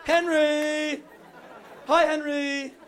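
loud, high mocking call. Fans taunting him, calling out loud and high. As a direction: call his name across the room.

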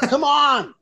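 high exasperated outburst; exasperated come on. Real, not acted. Teller exasperated at the clueless caterer, pitched high. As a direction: throw up your hands, come on. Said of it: "doesn't understand the fundamentals".

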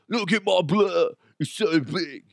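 lightly mimicked show-off; mocking, bragging imitation; mock braggart. Mocks the contestant's bragging.

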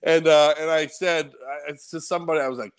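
normal talking voice

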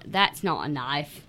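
slow, low Crocodile Dundee Australian; Australian accent. Acted. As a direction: attempt an Aussie movie-quote accent. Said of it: "I could do an Aussie".

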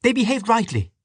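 very high, defiant retort; high-pitched indignant protest; crying out. A narrator's reading. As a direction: cry it out in a high woman's voice. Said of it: "cried Dante".

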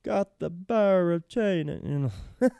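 a retold Italian shopkeeper accent, lightly put on; imitating Italian accent. Caterer reading an order, light mock Italian accent. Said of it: "He talks again with an Italian accent".

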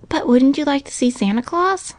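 put-on high child's voice; little girl coaxing. A narrator's reading. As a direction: coax a little brother, pitched high. Girl tempting her brother.

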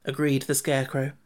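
ordinary delivery

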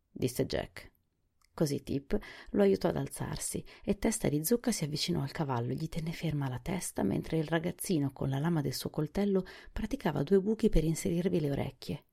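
plain reading voice

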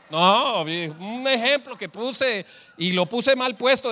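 usual speaking voice

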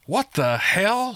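high, drawn-out exasperated snap; snapping. A narrator's reading. Margolin reacting to news of dogmen, snapping. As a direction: snap in mild disbelief. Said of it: "snapped Margolin".

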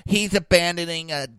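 high, loud imitated accuser; mock outraged witness. Imagined onlooker reporting him for dumping a kitten, mock outrage.

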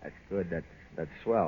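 swallowing the hurt, almost under his breath; crushed and hushed. Acted. Heartbroken, hearing of her wedding.

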